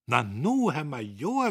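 gezwungen munter, laut und hoch; überspielt die Verlegenheit mit aufgesetzter Fröhlichkeit; erzwungene Munterkeit. A narrator's reading. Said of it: "mit erzwungener Munterkeit". (Diederich begrüßt den Major gezwungen munter)

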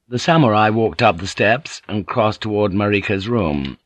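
ordinary delivery

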